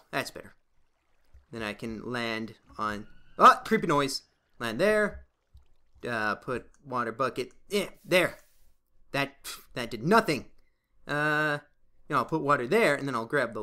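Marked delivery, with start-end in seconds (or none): none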